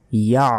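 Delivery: ইয়া closed with a squeezed ain; throat ain. Shown as a demonstration. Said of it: "এভাবে উচ্চারণ করতে হবে".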